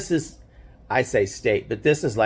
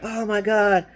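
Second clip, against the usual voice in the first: high, thrilled exclamation; teen jumping around after winning; excited scream; 12 semitones higher than usual. Retold or imitated. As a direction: squeal it with joy. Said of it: "jumping around screaming".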